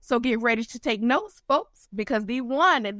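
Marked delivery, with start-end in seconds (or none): none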